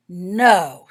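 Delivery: sharp shocked no, loud; a scandalized burst. A narrator's reading. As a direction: gasp it out, scandalized.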